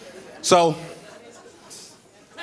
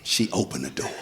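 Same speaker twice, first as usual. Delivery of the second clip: ordinary talk, nothing marked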